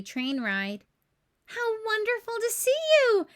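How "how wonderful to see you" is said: a much higher, louder, delighted greeting; delighted Missus Claus. A narrator's reading. Missus Claus greets the visitors at the train, delighted. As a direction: gush with warm welcome. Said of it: "said Missus Claus".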